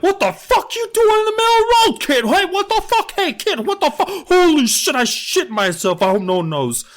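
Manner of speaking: high, loud hammed-up trucker yelling at a kid; freaked-out truck driver. Truck driver yelling at the kid on the road, hammed-up high shout. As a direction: squeal at the kid, then panic.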